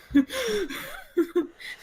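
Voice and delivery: laughing gasp. Retold or imitated. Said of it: "out of breath".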